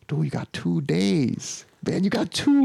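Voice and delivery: high, drawn-out, thrilled congratulation; retold elated cheering on; excited for him. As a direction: celebrate it slowly, pitch climbing. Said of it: "I'm so excited for him".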